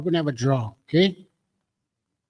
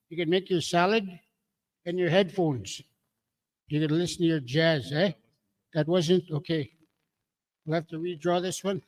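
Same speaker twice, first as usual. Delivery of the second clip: ordinary talk, nothing marked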